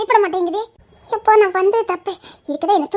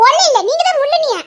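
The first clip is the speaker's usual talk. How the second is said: excited high protest; 9 semitones higher, 5 dB louder than usual. Acted. Playful quarrel at the water.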